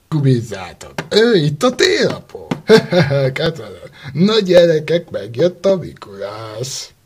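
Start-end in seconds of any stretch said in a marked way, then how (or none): none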